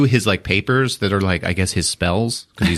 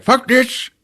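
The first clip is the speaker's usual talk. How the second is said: pitched-up exasperated wizard; high wizard curse. Retold or imitated. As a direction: spit it out as the wizard. Wizard flees.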